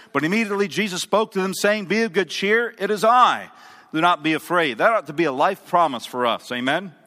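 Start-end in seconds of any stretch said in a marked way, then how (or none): 0.1-4.8 raised, projected pulpit reading; scripture reading. A narrator's reading. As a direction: read the verse out to the congregation. Reads scripture aloud to congregation.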